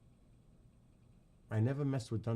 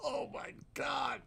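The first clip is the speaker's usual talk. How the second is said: high, drawn-out squeak; breathless from laughing; 5 dB quieter, slower than usual. Real, not acted. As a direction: gasp it out slowly after laughing.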